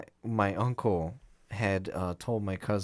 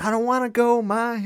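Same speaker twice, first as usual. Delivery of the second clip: put-on high, louder whiny kid voice; pleading kid; 12 semitones higher, 6 dB louder than usual. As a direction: beg mom like a little boy. Kid pleading with mom during fire.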